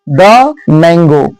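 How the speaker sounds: slow "the mango" with "the" as a short "duh"; slow classroom model of "the mango". Shown as a demonstration. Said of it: "ऐसे बोलते हैं the".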